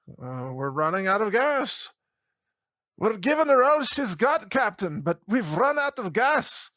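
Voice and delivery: hammed-up Scotty-like distress call; panicky starship engineer. Panicking gas operators, Star Trek engineer.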